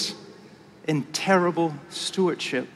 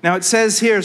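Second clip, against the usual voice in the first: ordinary delivery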